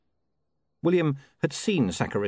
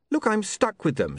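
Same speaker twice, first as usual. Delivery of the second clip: put-on higher, hurried young man; apologetic and caught out; flustered young man; 10 semitones higher than usual. William voiced by narrator.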